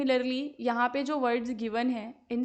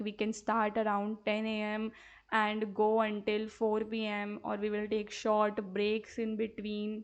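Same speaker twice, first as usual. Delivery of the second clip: very slow, clearly spaced words; slow dictation; 2 semitones lower, much slower than usual. Shown as a demonstration. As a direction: read it slowly so pupils can write. Teacher dictates dialogue line.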